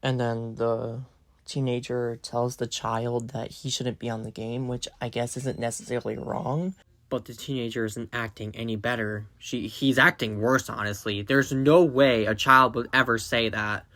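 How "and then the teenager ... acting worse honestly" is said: saving a sore voice. Real, not acted. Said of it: "my voice is starting to hurt".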